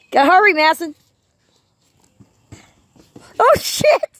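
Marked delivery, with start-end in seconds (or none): 3.4-4.1 sudden high yelp of surprise; startled cry. Real, not acted. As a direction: blurt it out, caught off guard. Dog nearly grabs the ball.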